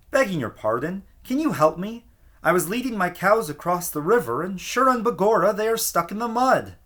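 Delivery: pleading plea. A narrator's reading. Little man pleads again.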